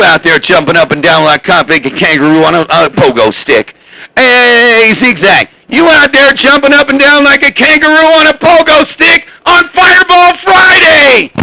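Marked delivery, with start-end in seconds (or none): 4.2-11.3 calling out much higher, shouting the end; radio shout. Real, not acted. Fireball hollering at Zigzag over the CB, rising to a shout. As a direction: yell it over the CB, building to Fireball Friday. Repeats the call, louder and higher.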